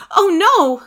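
high, pleading outburst; distressed cry. A narrator's reading. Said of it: "cried Daisy".

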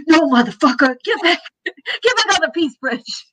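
high mock yell with laughter; hammed-up angry guard shouting; rude border guard. Americans turning back travellers, mock angry yell. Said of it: "That is my impression of the Peace Bridge".

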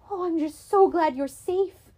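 mother relieved her son is safe. Acted. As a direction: melt from anger into relief.

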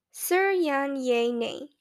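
slow, whispered syllable-by-syllable stumble over "Sir Yan Ye Nei"; halting attempt at the name. Teacher struggling to pronounce a new student's name, slow and whispered. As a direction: sound out "Sir Yan Ye Nei" carefully, each syllable apart, like a foreign word. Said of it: "attempts to sound it out".

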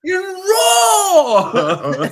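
very high, loud, drawn-out shout; hammed-up high-pitched chef bellow; mock Gordon Ramsay yell. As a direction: scream it slowly like an outraged chef. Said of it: "Gordon is yelling back at him".

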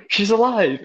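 high, amazed outcry; mock shock. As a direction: exclaim it high in acted disbelief. Acted shock in retold scene.

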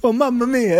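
high-pitched cartoon Italian; mock Italian accent. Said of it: "they have to say".

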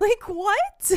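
high, loud disbelieving yelp; mock outrage. Friend mocking the character's shock, high loud yelp. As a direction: squeal it like you can't believe it.

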